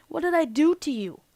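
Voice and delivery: acted-out wounded innocence; indignant protest. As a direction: demand an explanation, mildly exasperated. Retold quarrel, demanding a reason.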